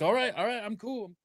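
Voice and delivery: high, soft backpedal; sheepish backing down. Retold or imitated. Host retelling how he backed down, high and soft. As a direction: meekly back off. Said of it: "you're like".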